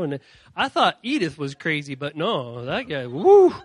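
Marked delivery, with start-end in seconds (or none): none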